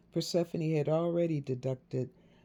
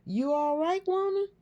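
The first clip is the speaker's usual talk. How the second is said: teasing; 5 dB louder than usual. A narrator's reading. Jasmine teasing her mother.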